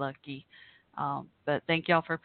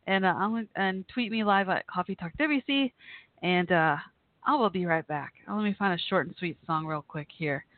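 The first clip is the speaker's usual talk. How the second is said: normal talking voice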